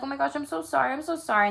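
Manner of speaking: hammed-up sorry from an angry partner trying to make up; mock apology. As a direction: gush the apology like a caught partner. Voicing the partner's frantic apology.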